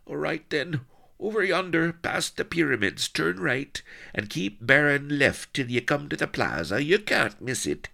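high-pitched country yokel; nervous rustic Solomon. Acted. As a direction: give directions in a high rustic voice. Solomon gives directions to the plaza.

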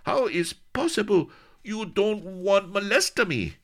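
put-on high, accented offended protest; high accented pout; indignant Spanish lady. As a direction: sulk at being ignored.

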